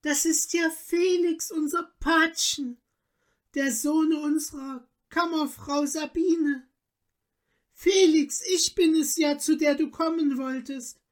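high, emotional countess voice; joyful recognition. A narrator's reading. Countess tells husband and godson who is who, joyfully moved. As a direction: let the wonder pour out, pitch held high.

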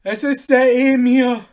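put-on high, loud call of an old rider; old groom's shout. A narrator's reading. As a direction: shout it out knowingly. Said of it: "rief ein alter Bereuter".

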